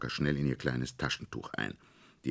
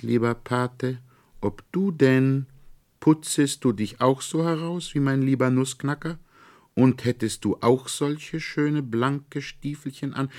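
put-on much higher child voice asking seriously; earnest girl; 10 semitones higher, 4 dB louder than usual. A narrator's reading. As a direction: ask the godfather in a child's earnest voice. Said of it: "sprach sehr ernst".

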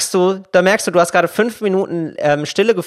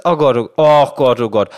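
put-on louder alarmed oh Gott while blowing on a hurt child; worried exclamation; 5 semitones lower, 4 dB louder than usual. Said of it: "Das ist".

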